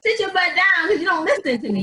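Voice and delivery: very high, loud comic telling-off; exasperated God. Retold or imitated. God ordering her to sit down, in loud mock exasperation. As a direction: scold loudly in playful exasperation. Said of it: "He's like".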